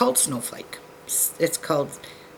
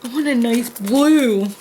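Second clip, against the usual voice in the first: whiny plea; 7 semitones higher, 5 dB louder than usual. Pretend. Whining over bead choice.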